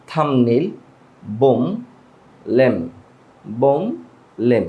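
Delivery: থাম্বনেইল, বোম, লেম all without B; corrected silent B. Shown as a demonstration. Teacher recaps the correct words, silent B. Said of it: "এইভাবে ঠিক উচ্চারণ".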